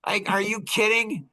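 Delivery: high-pitched exasperated disbelief; incredulous retort. Retold or imitated. Author answering whiners about his workbook, exasperated. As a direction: throw it back at them, pitch jumping up.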